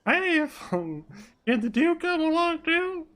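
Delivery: very high falsetto sidekick; high-pitched character request; Flop asking eagerly. Acted. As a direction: ask hopefully in a high put-on voice. Voices Flop asking a favour.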